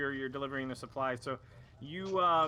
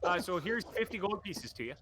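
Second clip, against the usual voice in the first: cheery shopkeeper; 7 semitones higher than usual. Acted. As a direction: greet them like a friendly dock trader. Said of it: "he turns to you and goes".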